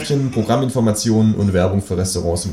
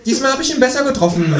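much higher satisfied quip; kangaroo voice; faster than usual. Retold or imitated. Kangaroo pleased about its kick at the dog, high voice. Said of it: "sagt das Känguru".

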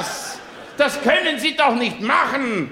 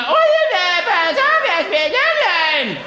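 screaming mother; 8 semitones higher, 3 dB louder than usual. Retold or imitated. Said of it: "Und dann schreit die immer".

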